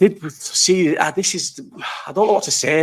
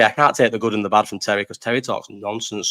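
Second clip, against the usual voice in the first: normal talking voice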